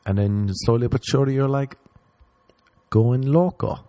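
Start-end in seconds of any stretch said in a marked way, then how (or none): none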